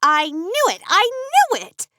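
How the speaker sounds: rising to a high gleeful cry; gloating with delight. A narrator's reading. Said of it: "let out a cackle".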